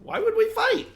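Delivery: very high-pitched, loud little voice; small squeaky creature. Acted. Tiny creature answers the talk of fighting, small and squeaky. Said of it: "it sounds small and squeaky".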